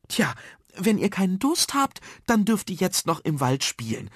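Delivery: high-pitched teacher's voice; suggesting teacher. Acted. Teacher suggests playing in the woods, encouraging. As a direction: offer a consolation, pitched high. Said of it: "schlug Frau Schill vor".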